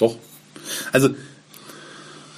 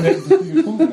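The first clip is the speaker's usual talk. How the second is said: laughing; 13 semitones higher than usual. Real, not acted. Joking about sounding drunk.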